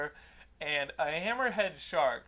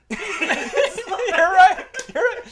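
laughing agreement; 13 semitones higher, 12 dB louder than usual. Real, not acted.

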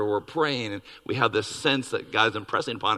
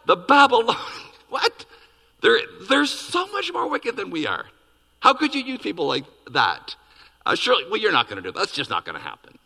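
freaking out; 7 semitones higher than usual. Retold or imitated. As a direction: gasp out a shocked prophet's protest. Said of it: "He literally freaks out".

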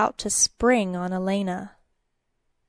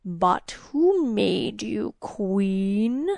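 very slow, drawn-out demon hiss; hissing creature; much slower than usual. A narrator's reading. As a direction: taunt her in a slow hiss. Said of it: "the creature hissed".

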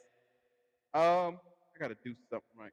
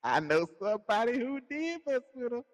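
teasing singsong; 12 semitones higher than usual. Acted. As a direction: needle him with a knowing tease. Teasing his cohost again.

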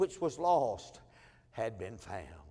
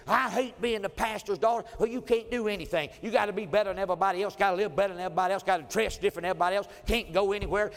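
louder, higher, fast, mock-furious teen; teen daughter's angry rant; 5 semitones higher, 5 dB louder, faster than usual. Pastor's daughter complaining to her father, fast and loud. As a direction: rattle off the unfair rules like a fed-up teenager. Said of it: "she'd get so mad".

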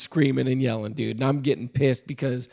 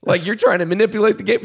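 angry yelling back; 4 semitones higher than usual. Retold or imitated. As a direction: fire it back at him, furious. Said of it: "I'm yelling back at him".

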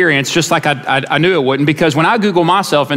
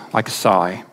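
sighing out the last words softly; breathy sigh, much softer; 7 semitones lower, 5 dB quieter than usual. Acted. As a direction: let it fade on a sigh. Psalm line ending in sigh.

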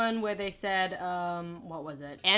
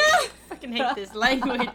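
laughing frustration; 3 semitones higher, 6 dB louder than usual. Real, not acted. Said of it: "laughing frustration".

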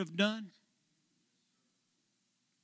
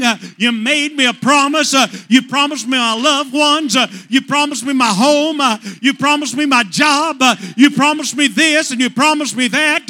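loud, high, rhythmic preacher's shout; preaching at full force; 6 semitones higher, 9 dB louder than usual. Acted. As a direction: build the fire line by line.

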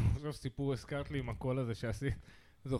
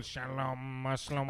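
voice box speech; 2 semitones lower, slower than usual. Retold or imitated. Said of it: "אחת עם קופסת קול".

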